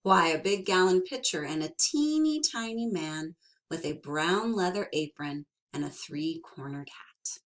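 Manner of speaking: ordinary delivery, nothing marked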